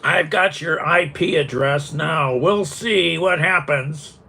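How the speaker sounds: high-pitched mocking imitation of a threat; mock butthurt threat. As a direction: mimic a sore loser threatening you, drawn out at the end. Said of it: "all butthurt and threatening me".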